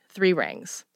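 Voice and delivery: usual speaking voice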